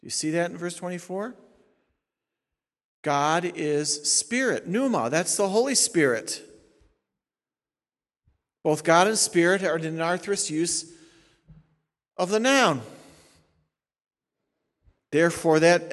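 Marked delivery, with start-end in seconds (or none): none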